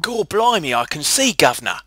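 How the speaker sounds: loud, high Cockney-style British caricature; exaggerated British accent yelled out in fun; mock British accent. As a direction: ham up a London street accent, shouting. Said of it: "I'm not doing the British accent".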